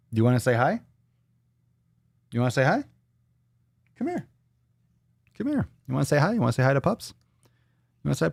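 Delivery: high coaxing voice for the pup; pet talk. Real, not acted. Owner coaxing the pup to come say hi, high pet talk. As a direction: sweet-talk the dog into coming over.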